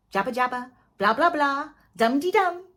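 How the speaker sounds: singsong mimic of idle chatter; mocking babble. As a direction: rattle off the nonsense mockingly. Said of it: "They love talking".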